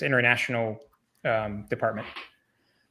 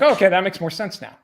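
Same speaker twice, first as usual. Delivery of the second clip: sarcastic realization; 6 semitones higher, 7 dB louder than usual. Retold or imitated. Sarcastic retold reply about bribes.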